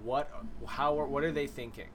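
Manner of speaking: ordinary delivery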